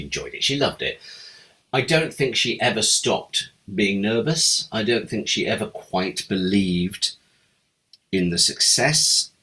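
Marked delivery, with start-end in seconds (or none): none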